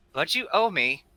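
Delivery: laughing, loud and high. Acted. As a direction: chuckle while insisting. Crow laughing insists.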